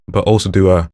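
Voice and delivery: sharp gasp inward; ingressive gasp. Shown as a demonstration. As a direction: gasp in through the throat. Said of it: "I can gasp".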